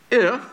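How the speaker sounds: "if" lifted, alone between pauses; stress on "if". Shown as a demonstration. Preacher marks the condition of blessing, stressing "if". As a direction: make "if" stand out by itself. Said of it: "I emphasize the word if twice".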